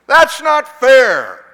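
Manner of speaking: loud, high, drawn-out whiny protest; junior-high yell. Acted.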